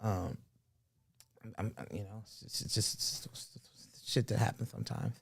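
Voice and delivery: soft, low apologetic impression; Mike Tyson. Mike apologizing to everybody out there, hushed apology. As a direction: apologize quietly, fading almost to nothing. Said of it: "he apologized to him".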